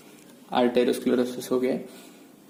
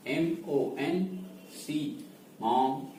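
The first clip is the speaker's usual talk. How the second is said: spelling aloud; 9 dB quieter, slower than usual. Shown as a demonstration. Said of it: "Let me check the spelling".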